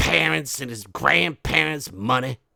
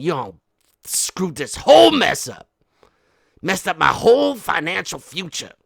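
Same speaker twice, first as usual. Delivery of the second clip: berating; 3 semitones higher, 7 dB louder than usual. Acted. Berating daughter.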